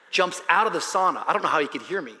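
ordinary delivery

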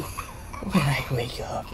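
faint whispered curse. Real, not acted. Whispering a curse as he goes under. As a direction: breathe the insult out slowly.

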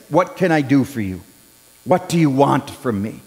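quietly voiced Jesus offering help; gentle Jesus. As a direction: ask it kindly and hushed. Jesus gently asks Bartimaeus.

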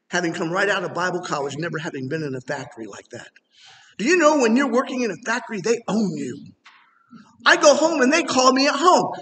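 normal talking voice

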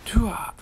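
short, high, loud hoo-ah; punchy high combat yell; attack cry. Real, not acted. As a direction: let out a sudden battle whoop. Attacking player in game.

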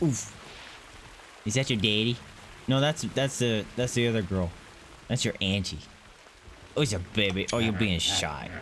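normal talking voice

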